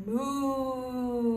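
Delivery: long, held cow moo. Shown as a demonstration. Instructor answering what the cow says, long moo. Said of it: "what does the cow say".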